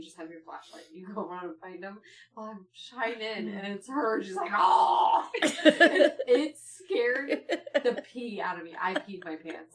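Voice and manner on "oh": loud breathy mock scream; imitated startled cry. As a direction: let out a sudden loud "oh", imitating.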